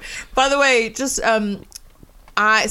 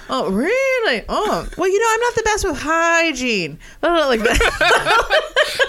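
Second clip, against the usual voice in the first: high, laughing impression of a friend; mock impression of a comedy partner; imitating him; 9 semitones higher, slower than usual. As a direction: play him, hammed up and high. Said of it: "That's a pretty good impression".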